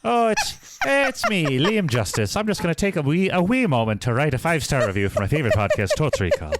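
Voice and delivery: hammed-up Irish brogue, opening high then settling; Liam Justice, Irish accent. Podcaster posing as the listener writing a review, in mock Irish. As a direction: introduce yourself in a mock Irish lilt. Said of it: "questionable attempt at an Irish accent".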